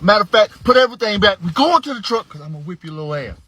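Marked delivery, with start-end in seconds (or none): 0.0-2.3 angry. Retold or imitated. Dad furious at son.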